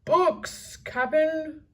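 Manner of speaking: high, loud crewman; pirate calling down. A narrator's reading. Pinky shouting down to the captain, high crewman yell. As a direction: shout down from the treehouse. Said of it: "Pinky shouted down".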